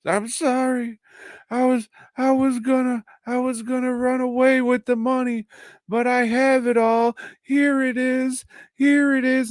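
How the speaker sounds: very high, loud desperate babble; frantic pleading. Retold or imitated. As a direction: squeak it out like a panicked madman. Lee pleads to the crowd.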